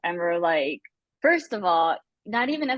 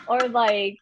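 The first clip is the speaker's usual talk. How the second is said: usual speaking voice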